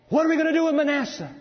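high, loud, rushed question; acted outcry; urgent appeal. God acted out, agonising over Manasseh.

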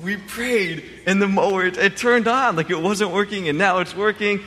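put-on high, excited little-boy voice; a small boy amazed that the prayer worked; overjoyed child. Three-year-old tells his dad the mower works, overjoyed and high. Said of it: "so overwhelmed with joy".

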